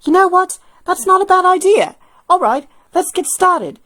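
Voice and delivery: pleased agreement, then settle in to work; Hilda agreeing. Acted. Hilda agreeing to work with Alpha, pleased.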